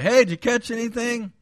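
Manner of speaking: high, loud passerby shout; mock calling out. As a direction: call over cheerily to someone fishing, imitating.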